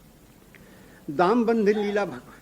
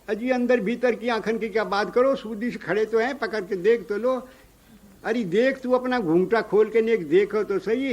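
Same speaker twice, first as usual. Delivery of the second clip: insistent; 5 semitones higher, 4 dB louder than usual. Acted. Gopi insists Yashoda look.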